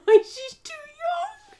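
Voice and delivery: high, slow words broken by laughter; laughing through the punchline; cracking up. Real, not acted. Cracking up at her own joke.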